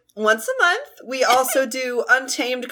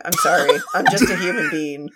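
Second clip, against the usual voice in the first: lower, laughing through the words; 7 semitones lower than usual. Real, not acted. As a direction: giggle it out apologetically. Laughing apology while singing the line.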